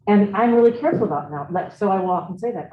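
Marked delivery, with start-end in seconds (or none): none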